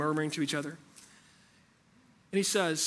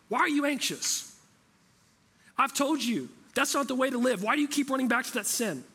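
exasperated scolding; 7 semitones higher than usual. Retold or imitated. Imagined angry God scolding a failing believer, exasperated. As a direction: scold like a fed-up parent who's said it a million times. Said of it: "That's how we imagine God would respond".

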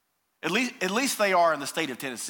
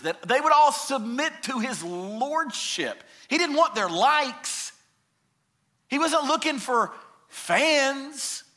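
raised, emphatic pulpit preaching; full-force preaching; 7 semitones higher, 4 dB louder than usual. Acted. Preacher pressing the church hard with rising force. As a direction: drive each claim home to the congregation.

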